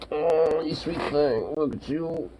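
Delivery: high, soft cooing at a puppy. Real, not acted. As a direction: coo sweetly at a dog. Cooing at a pet.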